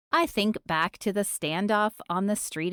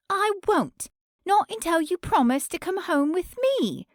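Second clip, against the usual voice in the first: a jealous woman spitting out blame; jealous stalker; 11 semitones higher than usual. Acted. Cady refuses Elliot.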